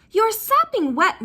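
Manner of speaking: high, loud scold; sharp motherly telling-off. A narrator's reading. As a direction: scold her like a fussing mother. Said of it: "Mother scolded".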